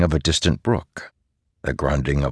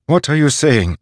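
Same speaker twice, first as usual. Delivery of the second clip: alarmed father; 9 semitones higher, 7 dB louder than usual. A narrator's reading. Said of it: "Father asked".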